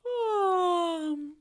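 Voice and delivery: big falling vocal yawn; long yawny sigh sliding down; pretend yawn. Shown as a demonstration. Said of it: "pretend like you're yawning like this".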